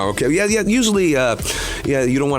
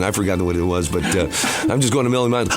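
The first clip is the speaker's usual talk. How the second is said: normal talking voice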